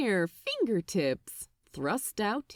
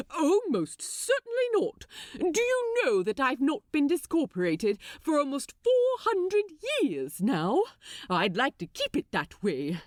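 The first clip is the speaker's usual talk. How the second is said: Aziraphale voiced higher, prim and alarmed; prim fretful angel; 5 semitones higher than usual. Angel refusing to climb the railing, prim and alarmed. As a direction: refuse primly, a touch panicked.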